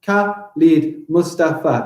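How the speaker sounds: slow "Khalid Mustafa" with a gap between beats; deliberate, separated syllables. Shown as a demonstration. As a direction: count out "Khalid Mustafa" in five. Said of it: "That is five".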